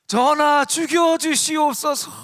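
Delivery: loud, high, drawn-out plea; historical drama courtier. Acted. Courtier begging the king in a period drama, theatrical plea. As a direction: wail it slowly like a pleading courtier. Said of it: "우리나라 사극에 보면 그런 게 있어요".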